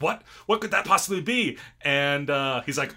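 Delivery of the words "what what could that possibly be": louder, higher disbelieving demand; a hammed-up gasp of a question; mock alarm; alarmed villain. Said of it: "she's like".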